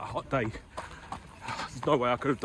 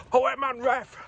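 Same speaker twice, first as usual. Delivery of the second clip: high, loud Geordie football yell; worked-up Geordie; 9 semitones higher, 4 dB louder than usual. Acted. Worked up at West Ham football, shouting in a Geordie accent. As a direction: heckle the referee in broad Geordie. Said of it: "the Geordie comes out as well".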